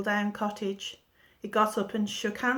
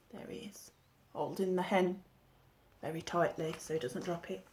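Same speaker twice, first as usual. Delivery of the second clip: hushed whisper, fading softer; 3 semitones lower, 9 dB quieter than usual. A narrator's reading. Narrator points Jack out to the children, whispered. Storyteller aside to listeners.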